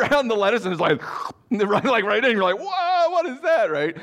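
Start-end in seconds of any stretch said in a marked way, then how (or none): 2.6-3.7 very high-pitched mock amazement; astonished. Visitor seeing the giraffe's tongue, high astonished yelp. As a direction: recoil in comic wonder.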